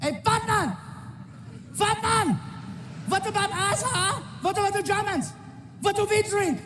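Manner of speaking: high mock German heckler yelling; hammed-up heckler shouting at the comic; angry German heckler. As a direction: play the big angry German demanding an answer. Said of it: "he's yelling".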